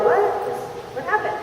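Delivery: high, rising puzzled question; taken-aback question. Acted. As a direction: ask it in bewildered surprise. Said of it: "And you're like".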